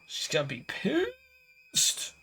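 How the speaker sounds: very high, slow, wailing whine; mock wail. Player dreading her master's anger, high mock wail. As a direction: squeal it in mock despair.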